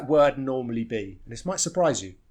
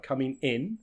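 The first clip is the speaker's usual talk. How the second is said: "in" stressed more than "coming". Shown as a demonstration. Teacher explaining a phrasal verb, stress on "in". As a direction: lean on "in" at the end of "coming in". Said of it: "we stress the in a little bit more".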